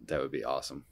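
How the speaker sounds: ordinary talk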